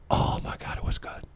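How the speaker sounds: breathy whisper of delight. Real, not acted. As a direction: hush it out, savoring the memory. Savoring food, whispered delight.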